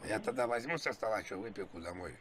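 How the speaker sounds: usual speaking voice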